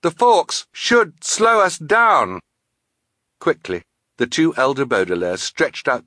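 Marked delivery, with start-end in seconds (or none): 0.0-2.4 slow, high, loud shout; high drawn-out emergency call; urgent cry. A narrator's reading. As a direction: call it out slowly over the danger. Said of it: "Violet cried".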